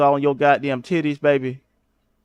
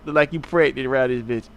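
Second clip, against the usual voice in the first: normal talking voice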